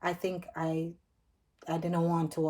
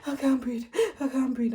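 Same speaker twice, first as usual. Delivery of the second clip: higher, urgent plea; panicked; 7 semitones higher, 4 dB louder than usual. Retold or imitated. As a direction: gasp it out in panic. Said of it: "I was telling him".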